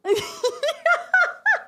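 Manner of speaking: squealing laugh, way up high; real delighted shriek of laughter; burst of high laughter. Real, not acted. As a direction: laugh hard and high at the joke. Big laugh at the teasing.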